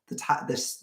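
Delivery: ordinary talk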